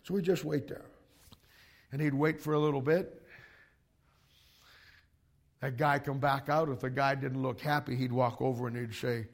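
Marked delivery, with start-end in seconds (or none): none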